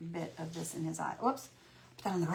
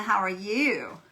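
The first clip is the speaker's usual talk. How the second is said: ordinary talk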